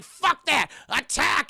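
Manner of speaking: high, loud cartoon war cry; angry command. Eustace rousing his mob, angry command. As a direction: order the charge loudly.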